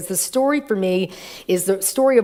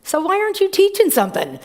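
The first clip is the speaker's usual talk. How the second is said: high challenging question; 11 semitones higher, faster than usual. Retold or imitated. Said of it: "And she goes".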